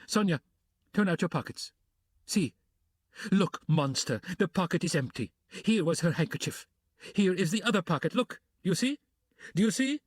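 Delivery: desperate mother flinging proof at the room; Katerina, frantic. Acted. Katerina showing the empty pockets, frantic and high.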